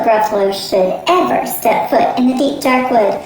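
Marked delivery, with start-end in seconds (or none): none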